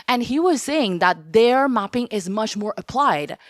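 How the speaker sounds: ordinary talk, nothing marked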